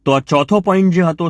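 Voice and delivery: ordinary talk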